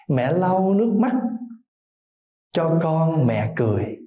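slow, tender poem reading; poem recitation. Acted. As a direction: recite each line with lingering pauses. (ngâm thơ chậm rãi, tha thiết)

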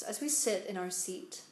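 calm meditation voice, spacious pauses; unhurried, soothing instructions, letting each phrase settle; guided meditation. Real, not acted. Guides breath and body relaxation.

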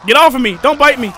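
loud, frantic protest; panicked yelling. Real, not acted. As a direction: shout to shake off an attacker. Enemy grabs the character.

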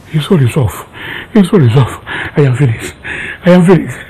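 low, sunken lament; despairing. Acted. Uncle finding his nephew's phone off, despairing. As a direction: let the voice drop, defeated.

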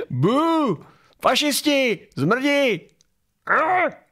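high, squeaky mock jeers; silly high-pitched booing and insults; mock protest heckling. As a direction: parody a heckler in falsetto. Parodies the clip's heckling protesters.

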